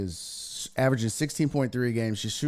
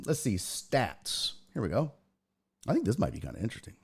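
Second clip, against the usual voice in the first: ordinary delivery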